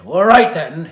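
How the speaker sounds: loud, high furious shout; angry shout. A narrator's reading. Seething villain to the laughing Ogmont, shouting angrily. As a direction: bark it before the throw. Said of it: "he shouted".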